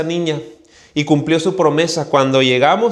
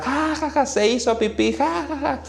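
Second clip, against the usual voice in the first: high, taunting schoolyard "ja, ja, ja", much higher and softer; singsong mockery of the boy who wet himself; mocking kids' laughter; 10 semitones higher, 6 dB quieter than usual. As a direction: laugh at him like a jeering child.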